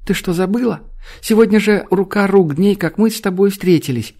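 put-on high, eager little girl. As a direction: coax her like a small girl.

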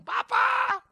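high, loud shouted demand for slaughter; hammed-up vengeful cry; mock vengeful plea. Pretend Jesus begging the Father to slaughter them, shouting. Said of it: "Dia nggak bilang".

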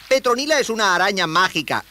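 put-on high young voice; high-pitched, keen youngster; proud boy explaining.

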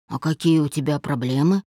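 plain reading voice

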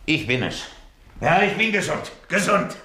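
sudden triumphant cry of health; jubilant. Acted. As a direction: crow it like a man reborn. Miraculous recovery, joyous outburst.

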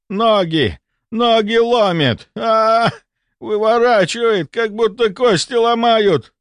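high, drawn-out agonized cries; screaming in pain. A narrator's reading. Patient clutching his calves, screaming in pain. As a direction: cry out as cramps seize you. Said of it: "Михаил Степанович вскрикнул так".

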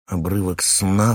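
plain reading voice

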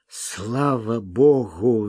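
slow breathless relief; out of breath. A narrator's reading. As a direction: gasp it out of breath. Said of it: "задыхаясь, проговорил Смок".

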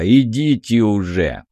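slow, grudging dismissal; reluctant concession. A narrator's reading. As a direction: let them go unwillingly, drawing it out. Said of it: "неохотно сказала та". (неохотно, протяжно отпускает)

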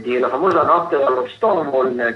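ordinary talk

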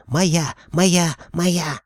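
high, excited repeated barks; barking like a dog. A narrator's reading. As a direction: yap each word like a randy dog. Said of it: "тут же залаял, как озабоченный пёс".

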